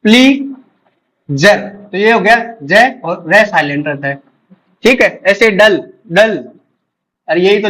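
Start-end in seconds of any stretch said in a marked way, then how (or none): 0.0-1.6 प्लीजर stretched very slowly, syllable by syllable; stretched model word. Shown as a demonstration. Teacher drawing out प्लीजर slowly for students. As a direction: draw प्लीजर out, holding each sound. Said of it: "जैसे ये प्लीज है".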